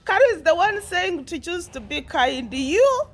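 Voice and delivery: high laughing talk; laughing disbelief. Real, not acted. Mocking Carol's advice, laughing. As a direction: say it still cracking up.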